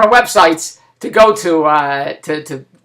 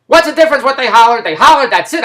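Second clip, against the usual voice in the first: louder, higher, exasperated comeback; exasperated retort; 5 semitones higher, 6 dB louder than usual. Retold or imitated. Friend dismissing a dinner-table question about the hollering, exasperated. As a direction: shut the question down impatiently. Friend snaps back in retold argument.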